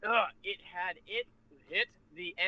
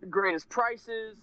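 slow, louder, stressing "Greatest"; emphatic echo; 5 semitones higher, 5 dB louder, much slower than usual. Real, not acted. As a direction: lean on "Greatest" and drag it out. Echoing a friend's point.

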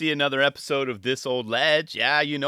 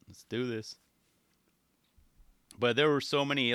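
normal talking voice

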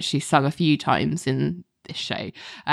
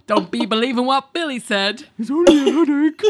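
character voice; 8 semitones higher than usual. Retold or imitated. Quoting show line in character voice.

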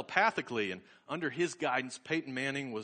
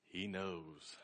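much softer, lower echo; hushed awe; 6 semitones lower, 10 dB quieter than usual. Retold or imitated. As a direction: let it sink to a private, knowing murmur. Crowed line repeated, hushed.

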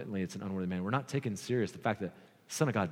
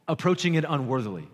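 ordinary talk, nothing marked

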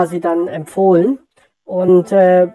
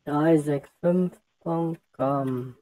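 slow, softer echo while typing; slow murmured repeat; 3 semitones lower, 9 dB quieter, much slower than usual. Real, not acted. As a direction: murmur it back as you type. (leise und langsam mitgetippt)

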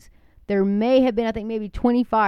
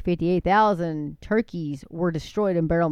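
ordinary delivery

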